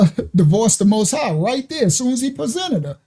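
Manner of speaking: usual speaking voice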